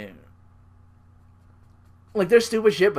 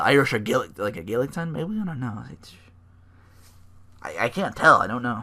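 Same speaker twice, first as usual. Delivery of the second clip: ordinary talk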